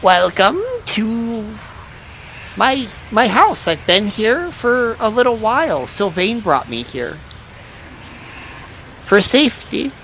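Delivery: put-on high, slow old lady voice; a sweet old granny welcoming guests. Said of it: "you hear it in the old lady voice".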